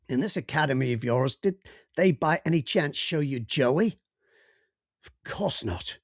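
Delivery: put-on probing question in an American accent. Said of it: "I hope my American accent wasn't far too off beam".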